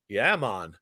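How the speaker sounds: low, relaxed Jamaican greeting; Jamaican accent. Retold or imitated. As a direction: drop low and easy, island style. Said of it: "a Jamaican lifeguard walks by".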